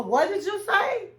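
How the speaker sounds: can't believe his ears; stunned disbelief. Retold or imitated. Husband hearing his wife's ultimatum, stunned disbelief. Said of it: "He had to do a".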